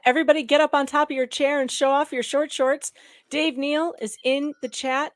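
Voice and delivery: loud, high hype call to chat; rally the chat, all pumped up; excited. Real, not acted. Hyping chat about a guest.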